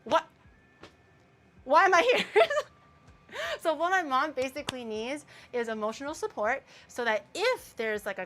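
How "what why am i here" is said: loud, high imitation of exasperated father; hammed-up incredulous outburst; baffled dad. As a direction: ask in mock bewilderment, voice shooting up. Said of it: "and then he's like".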